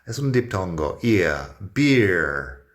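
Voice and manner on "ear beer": "beer" with a long i gliding into ear; teacher modelling the diphthong. Shown as a demonstration. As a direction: stretch the i of "beer" then slide to the r. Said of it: "la vocal es ear".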